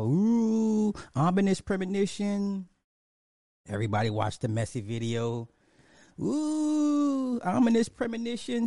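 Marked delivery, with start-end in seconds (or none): 0.0-2.7 much higher sarcastic "ooh" of fake dread; mock ominous. Mocking fans' spooky readings. 6.2-8.7 much higher sarcastic "ooh" of fake dread; mock ominous. Mocking fans' spooky readings.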